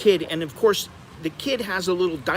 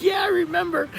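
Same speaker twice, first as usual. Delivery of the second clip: lightly imitated friend. Jay recalls the airport.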